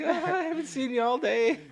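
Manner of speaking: dog voice. Retold or imitated. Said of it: "he just rubs on you like".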